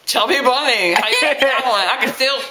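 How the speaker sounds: muffled through stuffed cheeks; cheeks stuffed full. Real, not acted. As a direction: mouth full of marshmallows. Twenty marshmallows in mouth.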